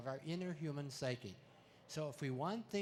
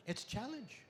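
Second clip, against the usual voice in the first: mock parent; 6 semitones higher than usual. Parent voice aside.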